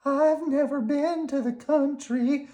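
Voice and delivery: high squeaky worm; high, cheerful little worm. Acted. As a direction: pitch it up like a tiny worm.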